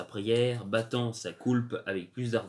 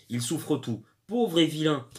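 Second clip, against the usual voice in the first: ordinary delivery, nothing marked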